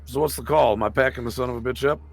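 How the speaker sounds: normal talking voice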